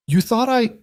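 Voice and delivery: high, stunned, breaking off; can't believe what she hears; shocked disbelief. A narrator's reading.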